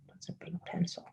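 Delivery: very soft, near-whispered aside; private thought. Retold or imitated. Teller seeing the carpenter's pencil, hushed private thought. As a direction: murmur it as if thinking to yourself.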